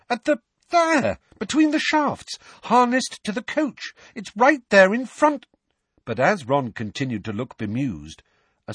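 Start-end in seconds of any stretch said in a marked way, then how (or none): 0.1-5.4 much higher and louder, pointing it out; urgent insistence. A narrator's reading. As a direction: make him see it, urgently. Harry insists, Ron can't see.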